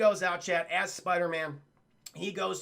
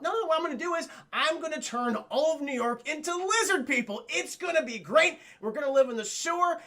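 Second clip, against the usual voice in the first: high, excited mad-scientist rant; overexcited mock-villain announcement; acted villainous glee; 7 semitones higher, 3 dB louder than usual. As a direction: proclaim the scheme with manic enthusiasm, hammed up. Villain announces his mad plan.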